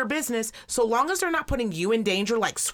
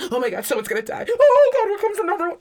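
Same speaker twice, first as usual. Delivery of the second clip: mock wail leaping high and loud; hysterical mother; 11 semitones higher, 7 dB louder, faster than usual. Crying mother sees another biker coming, hammed-up hysterical impression. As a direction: imitate a panicking mom, climbing into a squeal. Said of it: "her voice is getting higher and higher and higher".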